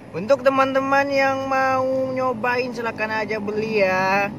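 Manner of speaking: high, slow, loud sales call; excited promo pitch. Acted. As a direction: sell it to the viewers with gusto. Host promotes buying the food.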